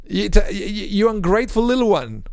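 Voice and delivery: exasperated, high-pitched parental scolding. Acted. Parent tempted to snap at a crying kid, exasperated scolding. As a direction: scold like a fed-up parent, voice jumping up. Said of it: "when the kids cry and say".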